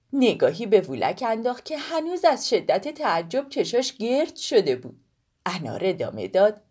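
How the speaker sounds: plain reading voice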